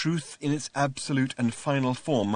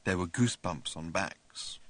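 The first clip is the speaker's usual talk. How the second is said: plain reading voice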